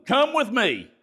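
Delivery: high-pitched, peremptory summons; mock bossy order. Shown as a demonstration. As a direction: demonstrate a tactless, high-pitched command. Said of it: "I love this one".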